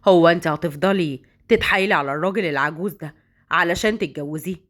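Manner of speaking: a man sweet-talking his lover, low-voiced; low male lover. Man on the phone with his girlfriend, low-voiced and cajoling.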